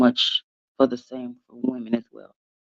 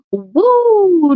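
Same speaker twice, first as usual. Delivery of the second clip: laughing whoop; 4 dB louder than usual. Real, not acted. Laughing at balloon dude joke.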